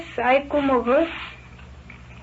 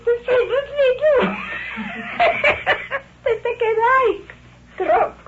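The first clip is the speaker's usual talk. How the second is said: high-pitched laughing through the words, close to tears; 9 semitones higher, faster than usual. Real, not acted. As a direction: laugh helplessly as you tell it, voice jumping up. Laughs while retelling police threat.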